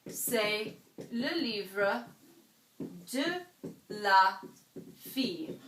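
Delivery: "c'est le livre de la fille" in careful French, pausing between words; French pronunciation. Shown as a demonstration. Teacher modelling a French sentence for the class. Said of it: "if I wanted to say".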